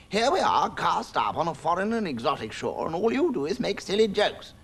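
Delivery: high, loud indignant mouse snap; Humphrey snapping at Samson. Acted. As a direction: scold the cat sharply. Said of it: "he snapped".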